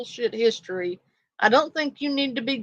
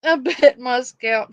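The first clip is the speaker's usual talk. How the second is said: ordinary talk, nothing marked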